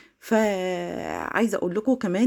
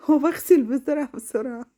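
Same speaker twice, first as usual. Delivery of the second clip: laughing; 4 semitones higher than usual. Real, not acted. Laughing while scrubbing fast.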